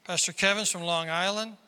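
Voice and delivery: usual speaking voice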